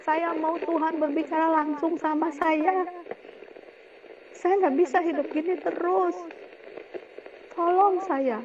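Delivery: high, pleading cry for help; desperate plea. Retold or imitated. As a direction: beg for rescue.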